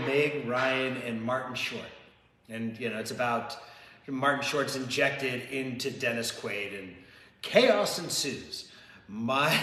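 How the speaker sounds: usual speaking voice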